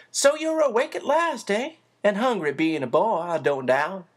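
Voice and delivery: put-on a busy motherly woman teasing the boy; bustling housekeeper.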